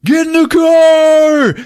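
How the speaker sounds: loud, high, stretched-out yell; hammed-up action-movie command; mock urgent shout. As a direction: holler it to get everyone moving. Urgent getaway order, retold.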